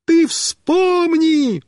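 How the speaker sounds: high, very drawn-out holler; slow high shouted demand; calling back. A narrator's reading. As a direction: yell it urgently, stretching the words. Rider shouting back to friend.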